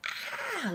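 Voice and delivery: sudden high shriek at a daddy long legs; startled scream. Retold or imitated. Startled by a daddy long legs flying at her face, high shriek. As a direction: let out a short, piercing scream of fright. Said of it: "it's really loud".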